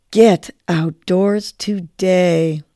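very slow, drawn out on the breath; slow on the exhale. Shown as a demonstration. Host repeats the nudge with listeners, slow exhale. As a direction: breathe the words out slowly.

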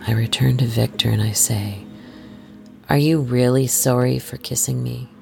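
plain reading voice

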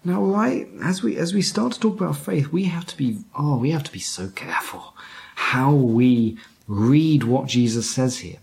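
ordinary delivery

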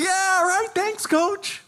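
very high, giddy imitation; mock-excited kid thanking the coach; excited younger self. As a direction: squeak it out in retold excitement. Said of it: "but I was excited".

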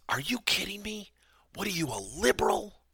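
hushed, half-mocking disbelief; retelling a friend's shocked jab, in a hushed mock whisper; mock incredulous whisper.

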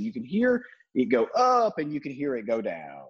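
normal talking voice

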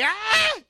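put-on a toddler's delighted cry; high baby shriek at a toy; baby's excited squeal. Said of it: "He was just like".

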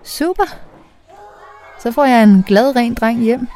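high, upbeat, smiling reply; smiling back. A narrator's reading. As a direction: return the smile in your voice. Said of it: "siger jeg og gengælder smilet".

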